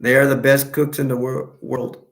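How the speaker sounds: usual speaking voice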